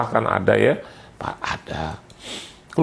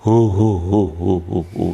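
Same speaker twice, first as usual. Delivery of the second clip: clownish ho-ho-ho. Acted. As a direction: hoot like a clown in church. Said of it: "Jadi kayak badut". (seruan ho-ho kayak badut)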